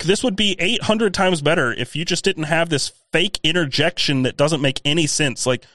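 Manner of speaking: ordinary delivery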